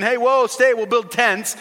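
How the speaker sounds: high, loud, urgent call; eager pleading. Acted. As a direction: call out to stop them leaving. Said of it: "and you're saying".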